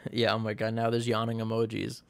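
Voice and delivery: usual speaking voice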